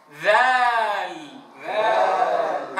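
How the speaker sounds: ذال stretched long with the lam clear; drawn-out letter name. Shown as a demonstration. Teacher repeats ذال slowly for students. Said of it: "بإعطائها حقها من البينية".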